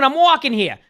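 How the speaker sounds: a hint of a New York yell on "I'm walking here"; mock New York accent. Streamer repeating the New York line, lighter mock accent. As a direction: toss it off like an annoyed New Yorker. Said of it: "I'm not gonna go".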